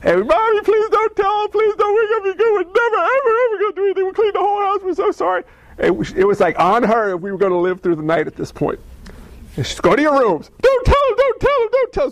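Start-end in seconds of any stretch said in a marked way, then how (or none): 0.3-5.4 put-on high, pleading little kid voice; frantic kid pleading with mom; begging child. Kids begging mom in story. 9.8-12.1 high, urgent hushed mother; mother shooing kids away; frantic mom. Retold or imitated. Mom voiced in story.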